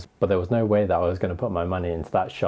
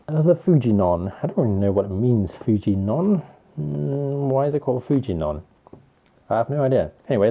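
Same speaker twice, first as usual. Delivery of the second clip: usual speaking voice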